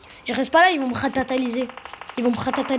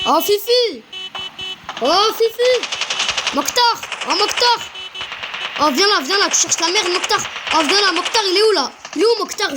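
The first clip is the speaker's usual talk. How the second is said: calling out; 7 semitones higher than usual. Real, not acted. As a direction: call him out. Calling out to an enemy.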